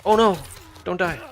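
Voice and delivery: high, loud panicked yell; panicked shout. Real, not acted. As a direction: scream it in a panic. Viewer panics at game death.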